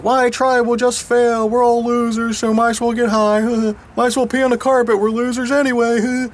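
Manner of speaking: mocking loser voice. Speaker parodying working-class neighbors giving up, high mocking voice.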